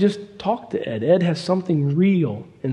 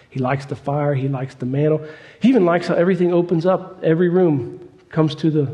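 ordinary talk

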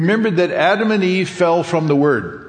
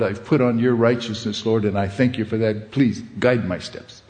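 lower, hushed earnest prayer; voiced prayer; 4 semitones lower, faster than usual. Preacher voices a believer's prayer.

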